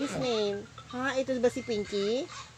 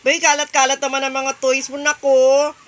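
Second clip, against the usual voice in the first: scolding; 10 dB louder, faster than usual. Real, not acted. Mother scolds about scattered toys.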